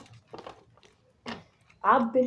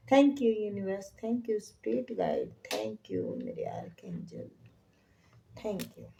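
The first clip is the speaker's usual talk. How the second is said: soft, hushed prayerful thanks; whispered thanks; 7 dB quieter than usual. Acted. Reader thanking universe and archangels in hushed whisper. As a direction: breathe the thanks to your guides.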